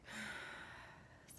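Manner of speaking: long, soft, voiceless exhale; demonstrated sigh. Shown as a demonstration. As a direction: sigh the breath all the way out. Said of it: "Sigh the breath out".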